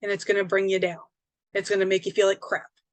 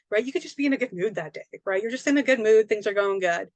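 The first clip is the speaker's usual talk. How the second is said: ordinary talk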